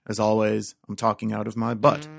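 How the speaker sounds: ordinary talk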